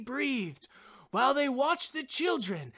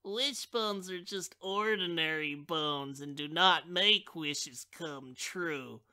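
put-on disillusioned child; 4 semitones lower, 5 dB quieter than usual. A narrator's reading. Child tossing away the wishbone, soft and glum. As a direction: give up on wishes glumly. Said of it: "cried the other child".

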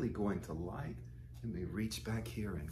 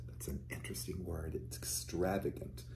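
soft whispered aside about a word; 4 semitones lower, 10 dB quieter than usual. Real, not acted. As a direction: lean in and whisper it.

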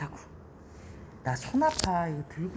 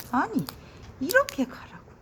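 frustrated cry; 10 semitones higher than usual. Real, not acted. Frustrated at beetle's direction.

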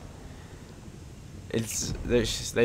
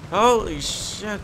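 real surprise; 9 semitones higher, 4 dB louder, slower than usual. Real, not acted. Surprise at in-game event.

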